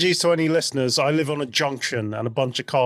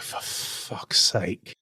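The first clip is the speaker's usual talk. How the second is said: slow, breathless exasperated mutter; exasperated whisper; 6 semitones lower, 6 dB quieter, much slower than usual. Retold or imitated. On seeing Cordelia pop up in Angel, whispered exasperation. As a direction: groan it under your breath. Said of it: "I was like".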